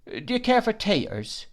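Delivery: high-pitched, ingratiating offer; insinuating waiter. A narrator's reading. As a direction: coax the guest with an insinuating smile. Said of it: "with an insinuating smile".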